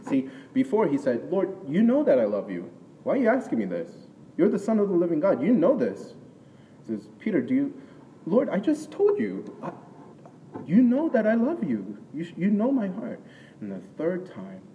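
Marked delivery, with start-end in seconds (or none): 1.3-6.1 rising, louder, faster pleading; exasperated protest. Retold or imitated. Peter's exasperated protest voiced. 7.2-13.2 much higher and louder, pleading; exasperated outburst. Retold or imitated. Peter cut off, exasperated.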